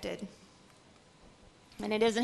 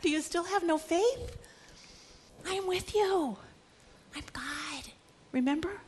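tender reassurance; 5 semitones higher, 4 dB quieter than usual. Acted. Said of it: "And he said to the disciples".

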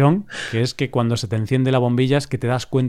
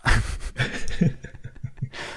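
laugh breaking through; 7 dB quieter than usual. Real, not acted. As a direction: laugh mid line. Laughs mid tough threat. (risa en medio)

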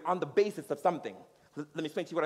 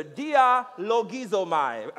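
"Dialogizomai" very slowly, syllable by syllable; slow teaching pronunciation; 5 dB louder, much slower than usual. Shown as a demonstration. Leading the congregation in saying it together, "Dialogizomai" drawn out. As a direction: draw out "Dialogizomai" for a group to repeat. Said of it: "Let's say it together".

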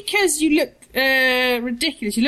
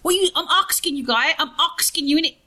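put-on higher, louder London teen slang; mock London slang; 5 semitones higher, 3 dB louder than usual. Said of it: "That's new Cockney".

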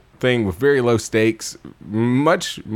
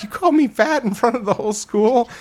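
indignant teacher; 10 semitones higher than usual. Retold or imitated. Acted teacher, offended by the dummy.

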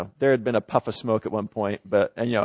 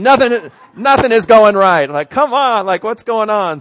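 high, loud cry of frustration at the day; exasperated outburst; 10 semitones higher, 10 dB louder than usual. Retold or imitated. Pastor reliving the breakdown, fed up, high and loud. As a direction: throw the words up, fed up with everything.

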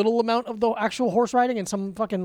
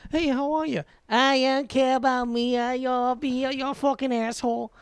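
softer, badly faked Boston accent; 3 dB quieter than usual. Shown as a demonstration. Host showing off his Boston accent, badly faked. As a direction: put on a poor Boston accent, trailing quieter. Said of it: "that's my Boston accent".

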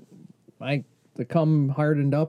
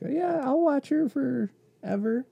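mom voice; 9 semitones higher than usual. Retold or imitated. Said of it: "my mom's like".

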